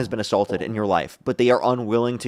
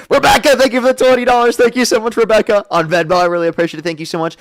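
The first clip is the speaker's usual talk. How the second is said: high, loud, bubbly gratitude; excited thanks; 10 semitones higher, 8 dB louder than usual. Real, not acted. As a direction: gush thanks at a donor. Thanking donor on stream.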